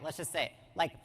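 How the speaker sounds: normal talking voice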